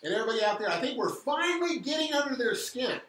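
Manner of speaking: fast, loud and high rallying; rally the listeners; fired up. Real, not acted.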